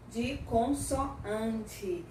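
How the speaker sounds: syllable by syllable, very slowly; drawn-out teaching delivery; slow emphasis. Real, not acted. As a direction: spell it out slowly for learners. Teacher stressing the key term.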